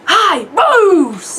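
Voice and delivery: high, loud acted call; loud greeting. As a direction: holler a greeting across to someone. Script read-through, loud greeting.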